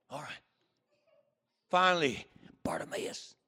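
urgent whisper of a name; whispered nudge. Acted. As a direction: hiss the name to a friend beside you. Buddy secretly alerting blind friend.

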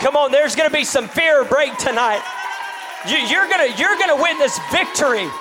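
loud, high-pitched shouted exhortation; full-force preaching. Real, not acted. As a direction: preach it out at the top of your voice.

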